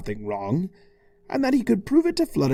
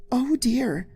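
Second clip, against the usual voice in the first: worried Fluttershy; 12 semitones higher than usual. Acted. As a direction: gently fret. Fluttershy dismayed.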